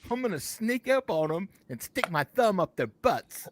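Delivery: loud, high, hammed-up Australian wildlife presenter; Steve. Host stalking the clones, loud Australian presenter impression. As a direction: confide the daft plan like an Australian croc hunter. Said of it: "I really miss Steve".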